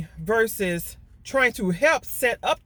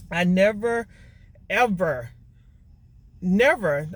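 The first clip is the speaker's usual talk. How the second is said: each word hit louder, with a pause between; emphatic never; 4 dB louder, slower than usual. Real, not acted. Telling listeners she never took credit, emphatic. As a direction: lean hard on never, ever, never.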